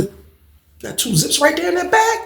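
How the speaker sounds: high, slow, stretched disbelief; mock amazed question; incredulous question. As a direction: draw it out in disbelief. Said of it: "I said".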